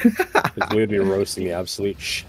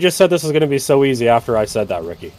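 higher, louder, fast rant; mock angry rant; 6 semitones higher, 7 dB louder, faster than usual. Real, not acted. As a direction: rant at a teammate in play anger. Teammate jinxed the run.

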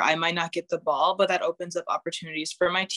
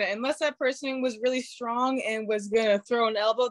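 normal talking voice

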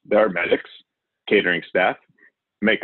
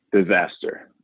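usual speaking voice

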